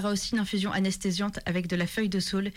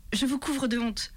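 starting to cry; 4 semitones higher, slower than usual. A narrator's reading. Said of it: "en commençant à pleurer".

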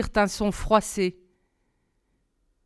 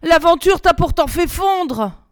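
loud, raised, effortful grumble; pained grumble; 8 semitones higher, 7 dB louder than usual. A narrator's reading. Girl grumbles in pain under the climber's weight. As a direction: complain through the strain of a heavy load. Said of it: "grogna-t-elle avec une mimique de douleur".

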